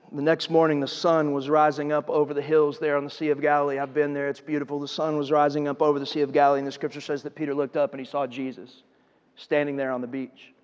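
low, soft, reverent narration; hushed scripture storytelling. A narrator's reading. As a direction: let the sunrise scene unfold quietly. Hushed telling of the sunrise.

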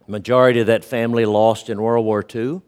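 usual speaking voice

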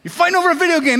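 high, loud scolding shout; mom yelling. Retold or imitated. Mom yelling at her sons wrestling over a video game, high and loud. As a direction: storm in and yell at the fighting boys.

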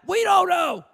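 loud, high, irate reply; irate employees. Retold or imitated. Workers in the break room answering their manager, irate and loud. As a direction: snap it back like angry workers. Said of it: "And I mean, they're irate".

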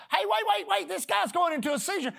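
retold holler. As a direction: call after him in alarm. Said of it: "I hollered and said".

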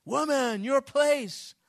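high, loud scornful put-down; mock a man who thinks women know nothing; dismissive general. General dismisses a woman, loud and scornful. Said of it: "You know".

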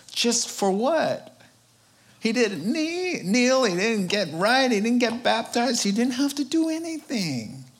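preacher's rising amazement; amazed emphasis. Real, not acted.

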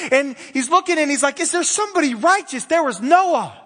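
loud, high, driving preacher voice; preaching. Acted. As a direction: preach it out with fire. Preaching at full force.

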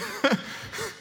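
short high chuckle; real laugh bubbling up after a punchline; amused laugh. Real, not acted. Preacher amused by Scrooge's reply, high chuckle.

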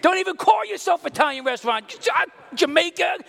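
high, loud, fast indignant tirade; comic outraged rant. Retold or imitated. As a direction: rail against the restaurant, mock-furious. Ranting at restaurant, shouting.

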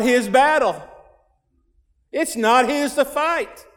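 slow, rising, emphatic preaching; preacher pressing the lesson on his hearers. Real, not acted. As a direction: build it up line by line.

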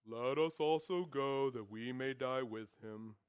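slow, low, gloomy donkey drone; mopey Eeyore voice, dragging and downcast; Eeyore impression. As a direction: sigh the words out like Eeyore, all hope gone. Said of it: "That's my Eeyore impression".

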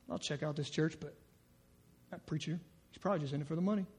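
a skeptic muttering doubts about the preacher; cynical churchgoer. Acted. Preacher voicing a cynical visitor doubting the church, soft and fast. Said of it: "we just get cynical".

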